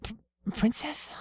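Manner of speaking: high, stammering nervous question; startled stammer. Acted. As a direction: stutter it, unsure and high.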